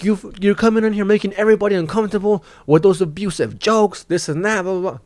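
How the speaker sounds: mock an outraged host going completely over the top; over-the-top rant. Angry host scolds comic for abusive jokes, over-the-top rant. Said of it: "Just fucking completely over the top".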